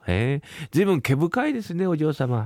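ordinary talk

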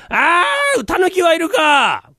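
loud, drawn-out call for the tanuki; 呼び出し. Acted. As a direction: bellow it slowly across the tenement. 長屋で狸を呼ぶ. (ゆっくり大声で呼びかける)

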